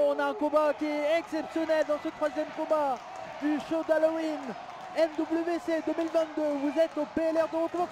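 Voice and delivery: ordinary delivery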